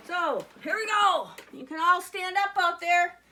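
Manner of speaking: 1 calling out. Real, not acted. Rallying kids to stand up.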